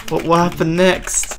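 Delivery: ordinary delivery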